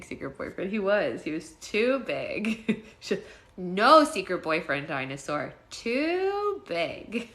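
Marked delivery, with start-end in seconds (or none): 3.6-7.3 imitating a child's firm refusal; Zoe. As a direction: mimic a little kid laying down the law. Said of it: "in the funny way that she says it".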